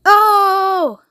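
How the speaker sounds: long, high moaning oh; grandma's theatrical lament; wailing. A narrator's reading. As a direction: wail in dismay. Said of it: "wailed Grandma Georgina".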